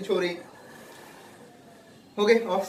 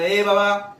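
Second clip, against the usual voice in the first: calling out; 3 semitones higher, 7 dB louder, much slower than usual. Acted. Robert calls soldiers from window.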